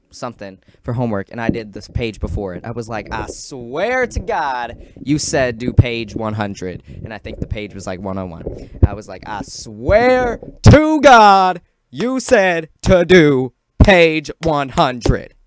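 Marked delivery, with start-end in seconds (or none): 3.1-6.8 drawn-out, emphatic retold protest; insistent protest. Student protesting to the Spanish teacher about homework, insistent. 9.3-15.3 slow, high, full-volume shout; outraged yell. Retold or imitated. Student arguing with the Spanish teacher over homework, outraged yelling. Retold protest blown up into yelling.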